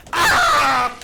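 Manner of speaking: loud, high yell; sudden high scream at the game; startled scream. Real, not acted. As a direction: yelp out loud in alarm. Startled during a hard game.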